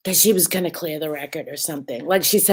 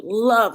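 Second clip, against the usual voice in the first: "Love" punched higher and louder; stress on love; 4 semitones higher, 6 dB louder than usual. Shown as a demonstration. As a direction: hit the word "Love" hard on its own. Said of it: "you emphasize the word love".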